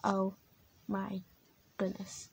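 low soft worn-out sigh; exhausted. Acted. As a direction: breathe it out drained. Exhausted after gibberish.

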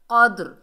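क़द्र loud, with the d echoing back; qalqalah bounce. Shown as a demonstration. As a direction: hit क़द्र firmly and bounce off the d. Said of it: "आवाज़ दोबारा आती है पलटकर".

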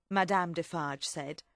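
plain reading voice

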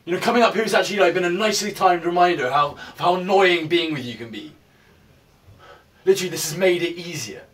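high, loud, bitter rant at his ex; furious shouting. Acted. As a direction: shout it all out, fed up.

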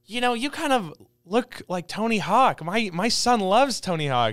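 imitated fan.

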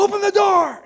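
high, loud yell at the door; frantic shout through a door; screaming. Retold or imitated. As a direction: bellow it, pounding to be let in. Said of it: "He's, like, screaming".

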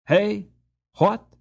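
high, loud, abrupt questions; startled cry. A narrator's reading. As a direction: cry out in sudden interest. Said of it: "cried the doctor".

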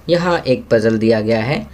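ordinary delivery

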